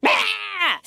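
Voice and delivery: sharp high yelp at the slam; mock startled cry. Startled by slammed door.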